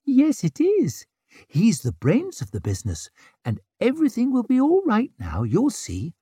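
put-on baby talk. Cooing at babies.

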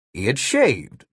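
ordinary delivery, nothing marked

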